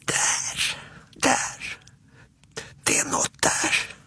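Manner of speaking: put-on frightened child's hushed whisper. Småtting pointing Alfons to the door, whispering. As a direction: whisper it haltingly, pointing out danger. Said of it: "pekar mot dörren och viskar".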